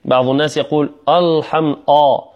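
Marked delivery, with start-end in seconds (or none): 1.1-2.2 الحم with a thick, dark opening آ; heavy hamza. Shown as a demonstration. Teacher showing students a common mistake, heavy hamza on الحم. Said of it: "تفخيم ال-، الهمزة".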